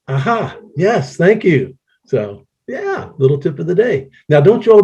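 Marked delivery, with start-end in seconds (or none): 0.1-1.8 mock thanks. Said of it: "what's it gonna tell me".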